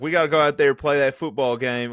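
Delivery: mock Southern twang. Mocking a quarterback's put-on Southern accent, hammed-up twang. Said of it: "some weird twang coming in and out".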